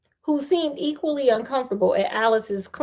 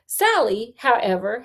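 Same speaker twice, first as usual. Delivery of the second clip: ordinary delivery, nothing marked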